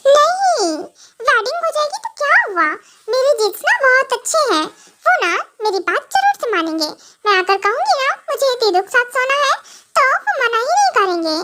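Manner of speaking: put-on high, pouty girlish voice, sure of herself; whiny confident girl voice; childish wife. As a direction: argue back like a spoilt little sister. Wife argues her in-laws agree.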